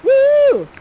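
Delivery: loud high whoop; high-pitched cheer; cheering "woo". Acted. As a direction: whoop like an adoring crowd. Cheers for the prince.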